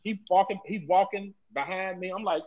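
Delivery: ordinary delivery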